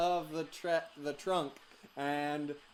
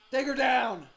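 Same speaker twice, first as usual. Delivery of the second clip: shouted order; 10 semitones higher, 11 dB louder, slower than usual. Acted. Agents ordered to seize spy.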